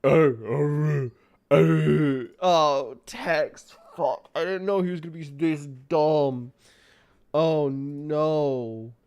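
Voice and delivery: loud, stammering dismay; loud distress. Real, not acted. Gamer groaning at Tex's rude dialogue, loud dismay. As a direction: groan at the screen.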